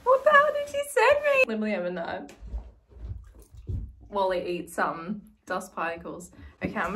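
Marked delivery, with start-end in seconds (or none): 0.0-1.4 high, loud, quick shriek of disbelief; retold outburst, pitched way up. Retelling her reaction to the parcel, shrieked disbelief. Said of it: "and I was like".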